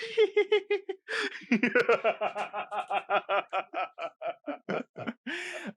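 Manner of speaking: high giggly schoolgirl laugh, drawn out. Retold or imitated. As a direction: mimic a guy's girlish giggle. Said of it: "He laughs like a schoolgirl".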